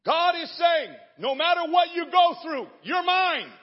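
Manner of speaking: high, loud preacher's shout; preaching at full force. Acted. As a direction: cry it out over the church. Preaching climax.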